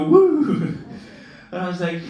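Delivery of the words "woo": high, loud gleeful woo; imitated woo. Mimicking himself steering the carrier right, loud gleeful woo. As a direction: whoop it like a kid on a ride. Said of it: "we go".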